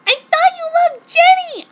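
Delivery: very high, loud furious shout; explosive angry shout. Acted. Narrator exploding at Jacob over Jenny, high and loud. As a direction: explode at him. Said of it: "I exploded".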